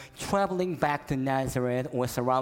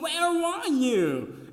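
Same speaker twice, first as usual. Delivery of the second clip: loud, high, slow angry yell; father yelling; 13 semitones higher, 5 dB louder, much slower than usual. Retold or imitated. Father finds his lost son, yelling loudly and angry. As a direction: bellow it across the mall. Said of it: "screaming and yelling".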